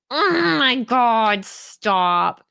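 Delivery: very drawn-out mock influencer reaction; exaggerated, slow social-media gush. Shown as a demonstration. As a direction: stretch each word like an influencer. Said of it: "I can do the influencer voice".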